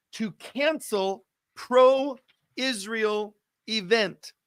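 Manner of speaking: slow, weighty news delivery with long pauses; dramatic headline read. Acted. Host announces breaking news to viewers tuning in, slow dramatic headline read. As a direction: spell out the shocking headline phrase by phrase.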